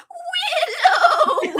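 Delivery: very high, loud, laughing cry of the name; high laughing Willow shout. Retold or imitated. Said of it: "Willow voice".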